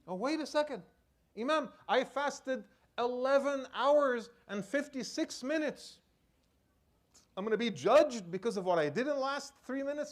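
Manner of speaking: high, loud, incredulous objection; indignant protest. Acted. Imagined worshipper objecting to the imam, indignant protest. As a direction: protest it high, stretching out the numbers.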